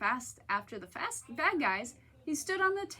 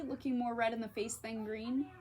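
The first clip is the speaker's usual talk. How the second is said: ordinary delivery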